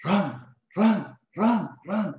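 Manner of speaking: high, insistent repeated command; imitated urgent voice. A mysterious voice warns him inside the warehouse, urgent. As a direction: imitate a sudden voice urging flight. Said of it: "tiba-tiba ada suara".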